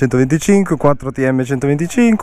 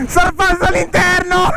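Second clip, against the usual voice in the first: excited shout; 13 semitones higher than usual. Real, not acted. Overtaking in race.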